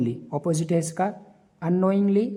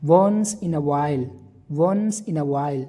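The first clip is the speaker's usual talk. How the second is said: slow, loud model; 5 dB louder, much slower than usual. Shown as a demonstration. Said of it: "teacher models the phrase".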